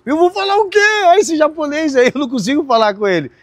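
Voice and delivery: high-pitched replay of his own balking; flustered protest. Retold or imitated. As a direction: protest in mock panic at being pushed to talk. Replays balking when told to speak.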